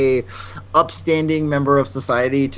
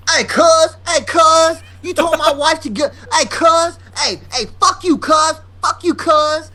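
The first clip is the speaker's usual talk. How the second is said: high-pitched, shouted confrontation; imitated angry husband; 12 semitones higher, slower than usual. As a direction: holler a challenge across the counter. Acts out angry husband confronting her.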